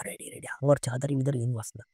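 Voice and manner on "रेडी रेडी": hushed conspiratorial whisper; barely audible prankster's reply; retold whisper. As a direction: whisper it like a secret go signal. Secret prank, whispered ready signal.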